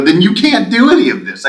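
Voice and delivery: indignant retold outburst; exasperated protest. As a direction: argue back loudly in disbelief. Retold argument with state official.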